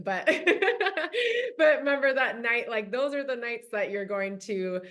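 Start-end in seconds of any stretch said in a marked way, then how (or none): none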